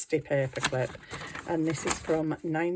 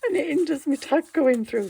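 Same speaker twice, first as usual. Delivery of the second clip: higher, louder, laughing frustration; laughing exasperation; 9 semitones higher, 6 dB louder than usual. Real, not acted. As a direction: laugh at your own mishap. Exasperated laugh at craft mishap.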